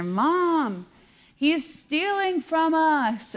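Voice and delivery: high, drawn-out mock kid cry for Mom; imitated child calling out. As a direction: mimic a kid raising the alarm, stretching the words.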